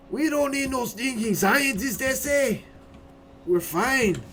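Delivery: high, loud, slow Mexican bandit parody; horrible Mexican accent. Acted. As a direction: belt out a bad Mexican accent. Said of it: "my horrible Mexican accent".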